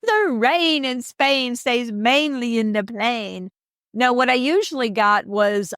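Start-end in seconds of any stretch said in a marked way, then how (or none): 0.0-3.5 slow, higher, put-on posh "The rain in Spain stays mainly in the plain"; mock proper accent. Shown as a demonstration. Kay repeats the elocution line in a put-on proper accent. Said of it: "repeat after me".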